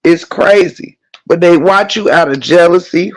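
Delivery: ordinary talk, nothing marked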